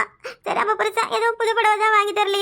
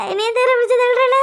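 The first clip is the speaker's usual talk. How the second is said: higher and louder anguished repeat; louder wail; 4 semitones higher, 4 dB louder than usual. Acted. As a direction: raise the complaint into a cry.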